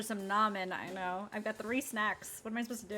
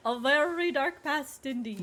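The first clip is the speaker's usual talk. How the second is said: put-on sinister old man laugh; 6 semitones higher, 12 dB louder than usual. Tolliver hints at dark secrets.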